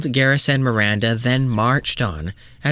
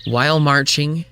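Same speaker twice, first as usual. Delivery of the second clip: ordinary delivery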